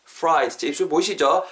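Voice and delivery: ordinary talk, nothing marked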